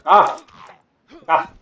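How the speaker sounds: loud high yelp; sharp cry of alarm; startled cry. Real, not acted. Zombie attack.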